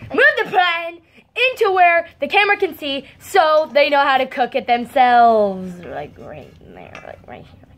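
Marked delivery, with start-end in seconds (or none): 5.8-7.7 hushed, barely voiced; low, quiet aside; whispering. Real, not acted. Hushed directions placing the pan.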